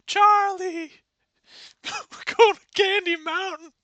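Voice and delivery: very high, loud silly voice; squeaky excited woodsman; woodsman Alcumutation. Acted. Woodsman calling to Charlie in a dream, very high loud voice.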